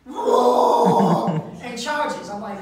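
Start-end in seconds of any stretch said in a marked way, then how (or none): 0.1-1.4 loud mock roar of a charging man; loud hammed-up roar; imitated roar. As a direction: roar it like an angry bull. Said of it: "The man roars".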